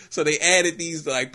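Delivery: ordinary delivery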